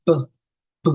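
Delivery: short "b" as a weak "buh"; clipped "b" with a tiny neutral vowel; b with schwa. Shown as a demonstration. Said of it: "we usually enunciate the consonant sounds with the schwa".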